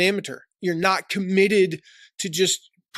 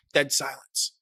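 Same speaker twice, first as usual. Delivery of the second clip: ordinary delivery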